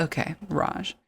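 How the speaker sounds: ordinary talk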